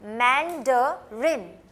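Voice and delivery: Mandarin drawn out very slowly, syllable by syllable; slow syllable demo. Shown as a demonstration. Teacher slowing Mandarin down so students can copy it. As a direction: spell out Mandarin slowly for the class. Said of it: "ना, रिन".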